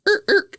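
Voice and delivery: high squeaky skid noise; imitated tire screech. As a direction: mimic the car's brakes squealing. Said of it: "I'm gonna make the sound of the impact".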